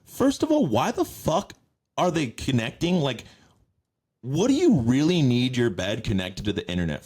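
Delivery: incredulous rant. Real, not acted. Ranting about smart beds.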